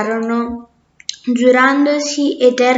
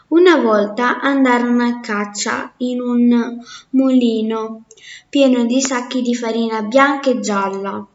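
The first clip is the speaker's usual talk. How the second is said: ordinary delivery, nothing marked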